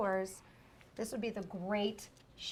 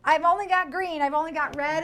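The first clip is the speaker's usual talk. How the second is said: bright higher quoted voice; voicing the producer; 6 semitones higher, 9 dB louder than usual. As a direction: play the busy producer leaving. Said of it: "She's like".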